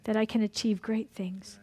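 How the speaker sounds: a hushed plea to the Spirit; whispered plea. Real, not acted. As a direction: breathe the words help me as a whisper. Whispered line in prayer.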